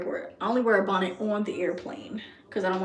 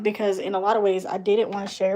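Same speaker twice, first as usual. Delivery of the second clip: ordinary talk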